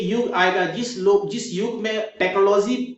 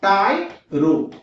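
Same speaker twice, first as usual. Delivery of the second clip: टाइरो in two separate beats, टाइ, रो; slow, deliberate टाइरो, pausing before रो; broken into parts; much slower than usual. Shown as a demonstration. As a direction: split टाइरो with a clear gap. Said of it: "तोड़िए".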